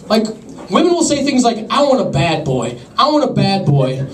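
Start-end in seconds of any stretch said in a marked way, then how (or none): 1.7-4.1 slow, breathy mock woman; mock woman's voice. Slow mock swoon, a woman saying what she wants in a man. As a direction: drag it out like a swooning girl.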